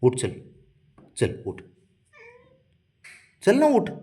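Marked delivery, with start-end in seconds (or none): 3.4-3.9 very high, louder call; high coaxing call. Real, not acted. Calling the sulking boy to get up, very high. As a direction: float it way up to tempt him out.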